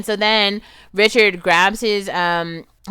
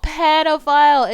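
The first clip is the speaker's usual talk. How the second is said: pedophile said again, higher, falling and firm; outraged repeat; 8 semitones higher, 4 dB louder than usual. Real, not acted. Vlogger repeating her verdict on the man, firmer and falling.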